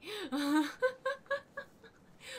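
squealing laughter; high shrieking laugh. Real, not acted. Startled by spider in game.

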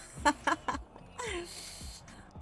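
breathy laugh. Real, not acted. Forgot the TV.

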